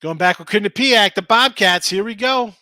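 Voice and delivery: loud, pumped-up announcing of the winner; excited. Real, not acted. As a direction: call it out with a hype. Announcing the day's winning pick.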